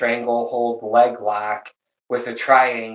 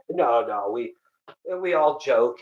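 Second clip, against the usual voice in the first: ordinary talk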